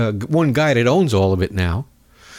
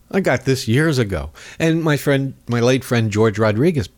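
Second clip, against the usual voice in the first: usual speaking voice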